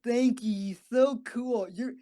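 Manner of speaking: high, slow, dreamy doped-up gushing; drugged gushing. Retold or imitated. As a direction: gush at the nurses like you're floating on anesthesia. Drugged praise for the nurses.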